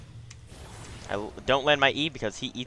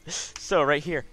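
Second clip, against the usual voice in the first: laughing; 7 semitones higher, much slower than usual. Real, not acted. Laughs after yawning apology.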